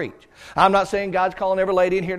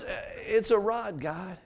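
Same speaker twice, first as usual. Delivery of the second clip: timid Moses; 8 dB quieter than usual. Acted. Preacher voices timid Moses.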